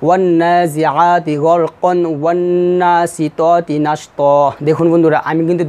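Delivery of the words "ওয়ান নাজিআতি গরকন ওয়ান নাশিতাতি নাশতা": very slow chant, "গরকন" keeping its n into the next verse; measured tajweed, n in "গরকন", open stretched "নাশতা"; joined verse recitation. Shown as a demonstration. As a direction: recite both verses slowly, stopping only on a long "নাশতা". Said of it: "এই দুটি আয়াত একসাথে পড়ি".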